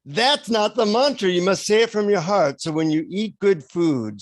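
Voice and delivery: put-on guru instructing in an Indian accent. Guru telling seeker to say it from the heart, Indian accent. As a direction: teach it earnestly with an Indian accent. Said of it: "in an Indian accent".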